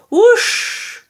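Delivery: chicken shriek. Acted. The chicken rocketing into the air, shrieking. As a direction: shoot the sound up like a startled hen. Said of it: "shrieked the chicken".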